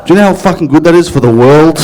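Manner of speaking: ordinary talk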